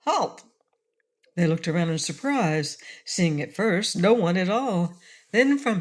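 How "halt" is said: shouted. A narrator's reading. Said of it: "a voice shouted".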